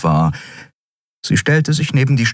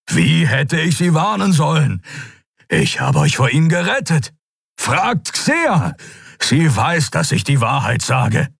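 much higher, pleading creature voice; desperate lion warrior; 9 semitones higher than usual. Acted. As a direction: beg them to believe you. Keru's desperate plea.

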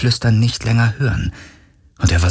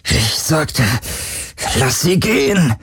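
higher, effortful insistence; strained demand; 7 semitones higher, slower than usual. A narrator's reading. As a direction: force out the order against resistance. Tormented hero demands her release. (angestrengt gepresste Forderung)

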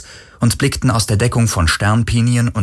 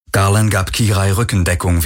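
ordinary delivery, nothing marked

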